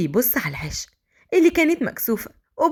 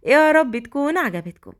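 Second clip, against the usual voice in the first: plain reading voice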